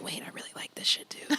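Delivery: hushed, whispered aside; whispered realization. Retold or imitated. Recalling her own surprise at liking it, whispered. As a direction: whisper it like a secret discovery.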